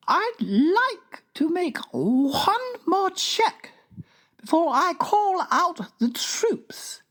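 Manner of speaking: very high, slow, prim royal voice; regal Queen. A narrator's reading. Queen tells the King about giants, high and stately.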